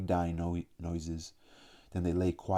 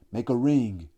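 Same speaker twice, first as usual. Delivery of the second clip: loud shout, higher and louder; 5 semitones higher, 7 dB louder than usual. A narrator's reading. Jack ordering the boys to circle Robert, a loud shout. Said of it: "Jack shouted".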